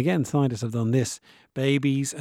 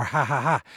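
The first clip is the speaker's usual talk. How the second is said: ha ha ha pushed out on each exhale; breathing-out laugh. Shown as a demonstration. Showing the audience adult egressive laughter, exhaled ha ha. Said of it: "I'm breathing out".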